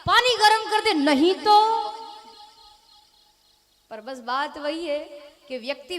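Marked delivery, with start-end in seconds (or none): 0.1-1.9 loud, higher threatening yell; angry shout. Retold or imitated. Preacher recalls the husband's furious threat to his wife. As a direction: shout the threat as if ready to kill. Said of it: "मार ही डालेगा".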